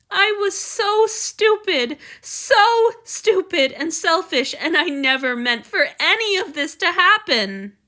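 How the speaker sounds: high, slow, tearful self-reproach; crying. Acted. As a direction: sob out the guilt. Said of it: "The tears spilled over now".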